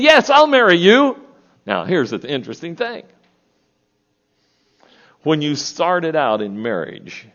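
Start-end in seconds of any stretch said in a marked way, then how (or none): 0.0-1.2 high and loud, bursting with joy; excited bride accepting; eager yes. Acted. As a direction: blurt out the yes happily.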